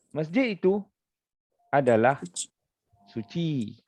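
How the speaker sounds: three spaced pieces falling to suci; pauses around adalah. Shown as a demonstration. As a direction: separate adalah with a break on each side. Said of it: "dimulakan dengan perkataan adalah".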